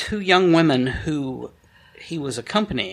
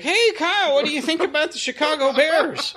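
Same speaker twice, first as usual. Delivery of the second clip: high-pitched, hammed-up fake casual chatter; forced nonchalance; 9 semitones higher than usual. Adults distracting each other from the sight, forced nonchalance. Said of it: "trying to very nonchalantly, like".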